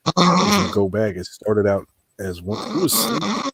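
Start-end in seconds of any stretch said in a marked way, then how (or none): 2.7-3.5 squeaky high teasing question; high mocking voice. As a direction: tease him in a high voice. Mocking a snorer.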